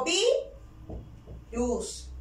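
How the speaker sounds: syllable demo "vi, luz", strong then weak; tone dropping on second syllable. Shown as a demonstration. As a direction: stress "vi", pause, let "luz" drop. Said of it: "Vemos que la tonalidad baja".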